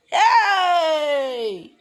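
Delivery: loud high laughing hey with a cry in it; laughing shout. Real, not acted. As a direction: burst out in a laughing yell. Crowd discovers what she did.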